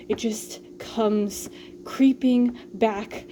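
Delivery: usual speaking voice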